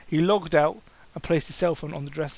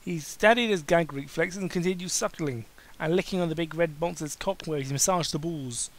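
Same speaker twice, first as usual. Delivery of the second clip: ordinary delivery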